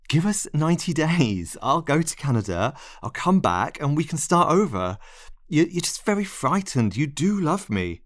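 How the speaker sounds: high, insistent begging; desperate pleading. Acted. Narrator clings to lover's feet, pleading desperately. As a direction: plead urgently, refusing to accept it.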